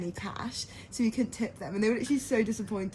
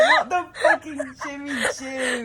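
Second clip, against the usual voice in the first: slow, louder wail through laughter; laughing disbelief; 4 semitones higher, 5 dB louder, slower than usual. Retold or imitated. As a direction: cry it out half laughing, half despairing. Retold horror at bag offer.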